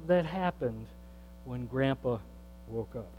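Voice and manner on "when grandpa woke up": when Grandpa woke up dropped to a soft, low hush. Real, not acted. Pastor to congregation, hushed in awe. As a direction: let woke up fall away almost to a whisper.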